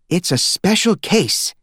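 mother telling the family the news; mother's announcement. Acted. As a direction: announce it slowly, a little mysterious.

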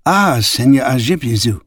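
mild exclamation; a small welcoming exclaim; understated eager greeting. A narrator's reading. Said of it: "the Japanese exclaimed".

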